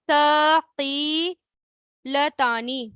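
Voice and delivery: ثقیلتانی drawn right out, each syllable held; very slow repeat. Shown as a demonstration. Teacher repeats the word for students to copy, very slowly. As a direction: stretch ثقیلتانی out for them to copy. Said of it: "پھر کیا آتا ہے؟".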